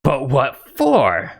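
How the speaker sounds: slow, puzzled ogre; wide-eyed ogre. Acted. Ogre offered gold for a race, slow puzzled ogre voice. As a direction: stretch the last word in ogre bewilderment. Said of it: "his eyes go wide and he says".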